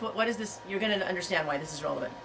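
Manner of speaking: normal talking voice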